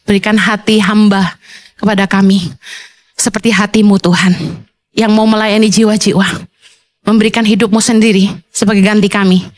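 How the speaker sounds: low, earnest prayer voice; closing prayer. Acted. Pastor leading congregation in closing prayer, low and earnest. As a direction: pray over the church, steady and devout.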